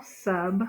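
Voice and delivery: SUB said alone, softly; isolated closed syllable "sub"; single syllable. Shown as a demonstration. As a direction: sound out just "sub". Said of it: "your first syllable".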